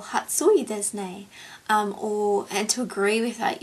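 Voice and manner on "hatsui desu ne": "Hatsui desu ne" said in a learner's Japanese; Japanese phrase. Shown as a demonstration. Recalling school Japanese, she says it carefully.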